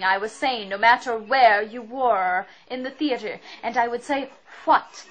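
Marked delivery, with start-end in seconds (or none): 4.6-5.1 "What?" said loud with a breathy hw at the start; "What?" with a clear breathed hw. Shown as a demonstration. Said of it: "because there is a W H".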